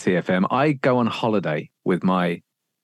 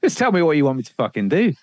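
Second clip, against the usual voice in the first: higher, fed-up pleading, faster at the end; exasperated plea; 6 semitones higher, much faster than usual. Retold or imitated. Presenter voicing fed-up staff to boss, exasperated higher plea. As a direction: throw up your hands, pleading. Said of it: "You'd be like".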